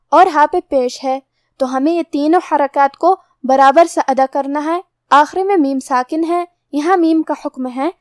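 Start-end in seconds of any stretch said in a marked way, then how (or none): none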